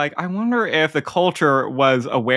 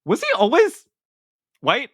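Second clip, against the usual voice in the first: startled disbelief; 8 semitones higher than usual. Retold or imitated. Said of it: "my first reaction reading this back was like".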